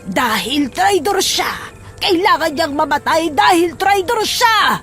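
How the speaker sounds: building condemnation. Acted. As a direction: draw it out and build in anger. (mabagal at tumitinding paratang)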